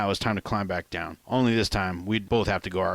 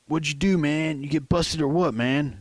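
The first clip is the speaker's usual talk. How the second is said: New York inmate; 6 semitones higher than usual. Acted.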